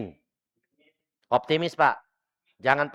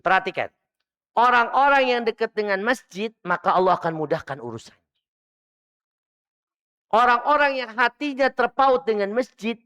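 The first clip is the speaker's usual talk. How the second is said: raised, high preaching voice; emphatic preaching; 10 semitones higher, 4 dB louder than usual. Real, not acted. Preacher urging the congregation to stay near the mosque, emphatic. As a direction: drive the message home to the congregation.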